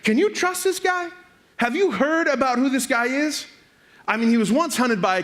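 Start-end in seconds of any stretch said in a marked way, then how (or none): 0.0-3.5 high-pitched, incredulous questioning; scandalized whispering-campaign questions, fully voiced; suspicious advisers. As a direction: sow doubt with raised pitch. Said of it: "the people who influence his thoughts say".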